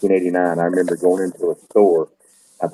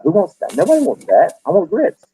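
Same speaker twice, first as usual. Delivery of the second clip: higher, indignant protest; 10 semitones higher than usual. Retold or imitated. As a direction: scoff at the cream of wheat in a higher voice. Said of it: "And I'm like".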